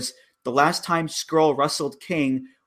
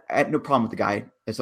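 ordinary delivery

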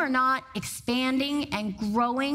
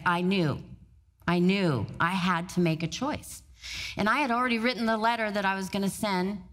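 ordinary talk, nothing marked

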